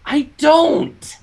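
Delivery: high, defensive little voice; high Spike. Acted. Spike defending himself to Twilight, squeaky. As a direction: protest in a squeaky pitch.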